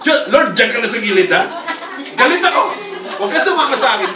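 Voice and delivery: hammed-up huff, high and loud; mock angry brush-off. Believer telling Lord to step aside, mock angry. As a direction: play a sulking angry believer.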